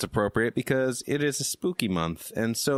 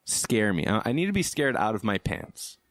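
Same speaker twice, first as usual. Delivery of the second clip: ordinary talk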